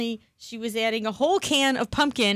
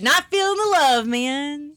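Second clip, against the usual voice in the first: higher, louder mock whine; hammed-up hurt; 5 semitones higher, 6 dB louder, slower than usual. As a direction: whine it like a wounded diva. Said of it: "I'm not feeling very appreciated".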